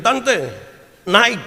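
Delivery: high, loud urging; calling auntie. Retold or imitated. A nephew urging his aunt from the village onto the mall escalator, high and loud. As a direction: call her up onto the escalator.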